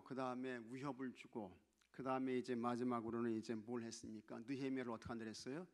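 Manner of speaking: usual speaking voice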